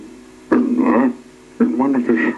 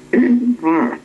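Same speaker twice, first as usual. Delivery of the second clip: high, louder goblin pointing; 11 semitones higher, 4 dB louder than usual. A narrator's reading.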